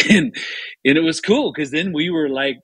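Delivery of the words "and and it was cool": ordinary delivery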